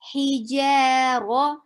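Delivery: Hijaro slowly with a heavy ro. Shown as a demonstration. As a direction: drag and darken the ro of Hijaro. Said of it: "you make heavy sound".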